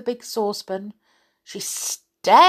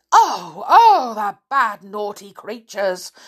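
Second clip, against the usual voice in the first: put-on louder dismayed old woman; old woman crying out; 6 dB louder than usual. Old woman dismayed at her children, loud cry. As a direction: wail over the naughty ones. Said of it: "cried the old woman".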